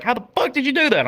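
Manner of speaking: high, fast, shocked question; high rushed mock amazement from the friend; stunned disbelief. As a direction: blurt it out like you can't believe your eyes. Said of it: "And he's like".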